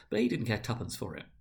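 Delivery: plain reading voice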